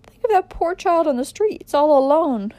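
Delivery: high, loud pleading wail; anguished high-pitched appeal; distressed cry. A narrator's reading. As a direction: plead tearfully as a distraught mother. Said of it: "cried the mother".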